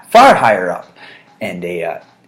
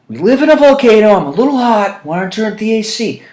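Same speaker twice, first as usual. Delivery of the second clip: high, loud hammed-up complaint; husband retelling the volcano complaint; mock exasperation; 12 semitones higher, 8 dB louder than usual. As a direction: overplay the frustration. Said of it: "replaying the heat argument".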